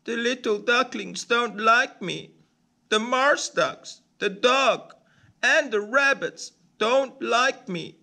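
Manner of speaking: a little bird listing who rejects him; tiny-voiced duckling, hurt and alone; sad ugly duckling. A narrator's reading. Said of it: "He says".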